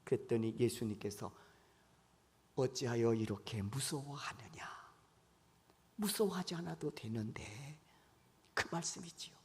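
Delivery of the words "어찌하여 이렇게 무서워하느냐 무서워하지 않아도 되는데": soft whispered 어찌하여, calm and tender; hushed gentle rebuke. Retold or imitated. Jesus quietly reassures the terrified disciples in a whisper. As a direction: whisper it like soothing a scared child.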